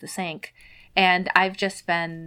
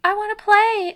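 put-on child voice; 6 dB louder than usual. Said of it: "Remy's like".

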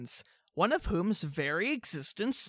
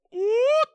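high whooping call; Bigfoot call; 14 semitones higher, 5 dB louder than usual. Retold or imitated. Hunters in the woods calling out to Bigfoot, high whoop. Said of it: "and they go".

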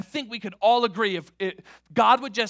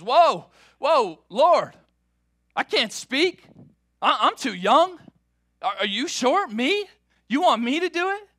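put-on startled young Jeremiah; 9 semitones higher, 8 dB louder, slower than usual. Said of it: "And Jeremiah says".

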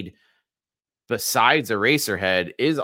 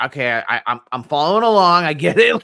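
higher, louder chirpy agreement; eager viewer; 6 semitones higher, 6 dB louder than usual. Retold or imitated. Mock eager viewer following a wacky movie. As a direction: nod along brightly. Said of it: "you're like".

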